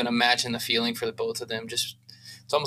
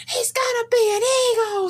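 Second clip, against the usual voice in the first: very high, loud mock scream of a thrilled mom; 4 dB louder than usual. Mom screaming as her son gets drafted, high joyful shriek. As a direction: shriek it like an overjoyed mother in the background.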